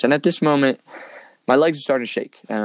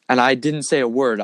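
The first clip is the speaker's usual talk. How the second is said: normal talking voice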